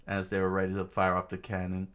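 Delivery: ordinary delivery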